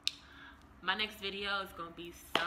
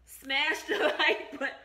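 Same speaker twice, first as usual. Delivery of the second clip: slow, louder, breaking into laughs; laughing; 7 semitones higher, 9 dB louder, slower than usual. Real, not acted. Vlogger retakes her subscribe line, laughing through it. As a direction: try it again but crack up midway.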